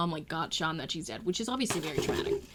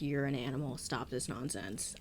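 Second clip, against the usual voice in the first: low, quiet reprimand; stern dad; 5 semitones lower, 7 dB quieter than usual. Retold or imitated. Dad scolding his son after the game, stern. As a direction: lower the voice, slowing into the order. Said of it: "His dad's like".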